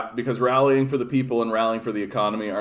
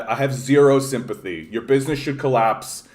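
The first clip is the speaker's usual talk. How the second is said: ordinary talk, nothing marked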